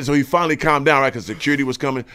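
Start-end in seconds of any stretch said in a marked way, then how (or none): none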